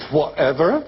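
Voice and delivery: mock "whatever". Mimicking the kind of people who go whatever, mock dismissive. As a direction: toss it off like those people. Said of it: "kind of people who go".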